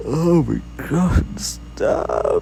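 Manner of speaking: loud, high, slow yawned words; exasperated mid-yawn groan. Real, not acted. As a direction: yawn right through the exclamation. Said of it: "I've yawned myself".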